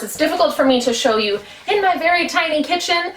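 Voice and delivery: ordinary delivery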